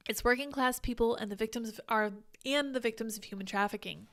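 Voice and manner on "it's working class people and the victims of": ordinary delivery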